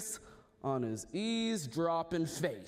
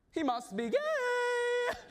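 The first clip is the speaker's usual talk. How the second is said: much higher, slow, sing-song gossip; mock gossip; 3 dB louder, slower than usual. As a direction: camp it up like whispering courtiers. Court gossips about Hamlet.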